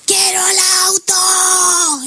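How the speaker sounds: hammed-up crying child demanding the toy car; mock toddler tantrum. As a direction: wail it like a kid thrown on the floor. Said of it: "se tira al piso, empieza a llorar".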